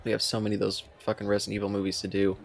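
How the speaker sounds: ordinary talk, nothing marked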